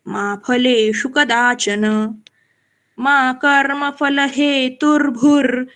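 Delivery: slow, measured verse; slow shloka recital. Shown as a demonstration. Teacher recites the verse slowly. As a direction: chant it slowly, carrying the half र. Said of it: "र आधा इधर लेना है".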